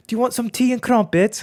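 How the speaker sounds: very high, loud mock British; hammed-up, badly faked British accent; attempted UK accent. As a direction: put on a silly high-pitched posh British voice. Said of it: "I try to do, like".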